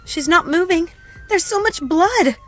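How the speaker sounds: high, loud, distraught report; tearful panic. A narrator's reading. Helena tells operator her sister is limp, tearful panic. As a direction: cry it out in horror. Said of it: "Helena's tears crawled down her cheeks".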